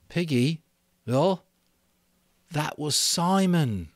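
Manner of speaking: put-on slow, heavy boy's voice; stricken Ralph. Ralph confessing to Piggy, slow and heavy. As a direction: let the guilt weigh each word.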